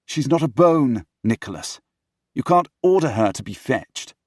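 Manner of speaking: loud, high, argumentative; heated remonstrance. Acted. Henry gripping his brother's shoulders, heated remonstrance. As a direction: talk sense into him forcefully.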